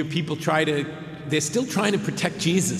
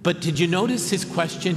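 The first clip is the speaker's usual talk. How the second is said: usual speaking voice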